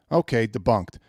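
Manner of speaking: ordinary delivery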